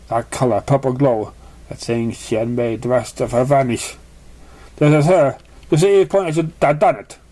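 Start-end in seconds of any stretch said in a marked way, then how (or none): none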